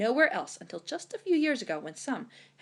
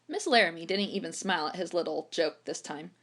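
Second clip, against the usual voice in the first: ordinary delivery, nothing marked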